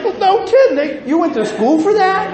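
very high, loud, laughing sarcasm; sarcastic. Retold or imitated. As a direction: scoff at the doctors with a laugh. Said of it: "I'm like".

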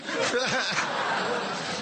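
high delighted laugh; a burst of happy laughter. Retold or imitated. Laughing after hearing he's favorite.

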